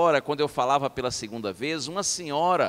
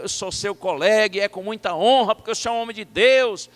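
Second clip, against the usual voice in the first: loud, raised, emphatic retelling; alto volume; 4 semitones higher, 7 dB louder than usual. Retold or imitated. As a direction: talk up to a hard-of-hearing colleague. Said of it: "de alto volume". (falando alto, com entusiasmo)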